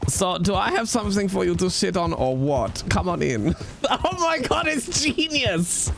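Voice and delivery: put-on German accent. Franz selling monk table.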